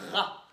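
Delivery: "ra" with a long rumbling R; growled R syllable. Shown as a demonstration. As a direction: sound out "ra", growling the R first. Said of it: "on a dit que c'était". (« ra », R longuement grondé)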